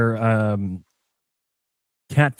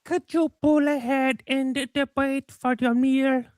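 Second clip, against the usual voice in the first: very high, squeaky mock worker voice; squeaky drive-thru worker. Co-host topping the impression with a high squeaky worker. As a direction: parody the worker in a tiny high voice. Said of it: "They say it like this".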